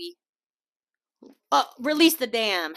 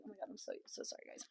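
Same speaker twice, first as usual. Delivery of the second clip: flustered apology whispered under the breath; embarrassed whisper; 26 dB quieter, faster than usual. Real, not acted. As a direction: whisper it, blushing and giggly. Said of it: "how red I'm getting".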